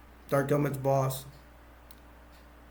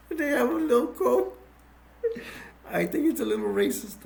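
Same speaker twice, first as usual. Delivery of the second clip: laughing; 9 semitones higher than usual. Real, not acted. Cracking up at the joke.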